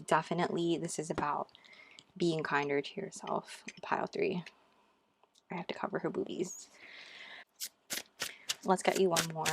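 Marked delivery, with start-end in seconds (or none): 3.8-6.7 whispering. Real, not acted. Hushed aside while covering card.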